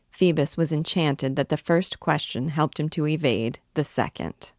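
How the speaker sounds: ordinary delivery, nothing marked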